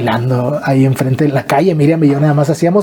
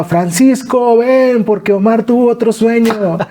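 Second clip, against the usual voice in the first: higher, carrying call to Francisco; mother calling out; 7 semitones higher, slower than usual. Retold or imitated. His mother calling Francisco to hear the dream, high call. Mother calls father to table.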